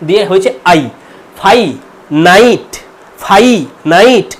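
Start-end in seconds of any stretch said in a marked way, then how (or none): 1.4-4.3 ফাই নাইট with the diphthong ai, spelled out slowly; RP finite. Shown as a demonstration. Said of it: "এটা এর sound British RP অনুযায়ী".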